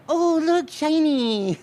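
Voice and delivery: high, gleeful mock wonder; mock distracted. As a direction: pipe up as if dazzled mid-thought. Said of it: "we get distracted by something shiny".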